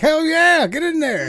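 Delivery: high, loud mock hype; a loud, high mock hype cheer, urging someone to join in; mock hyped cheer. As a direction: pump up the crowd, jokingly. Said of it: "you'd have been like".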